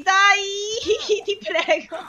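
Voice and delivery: high wail dissolving into a plea; stretched, whimpering entreaty; desperate pleading. Real, not acted. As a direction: beg through nervous, laughing whimpers. Player begs during tense escape.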